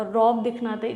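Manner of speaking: usual speaking voice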